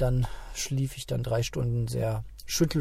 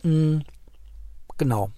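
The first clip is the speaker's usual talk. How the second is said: ordinary delivery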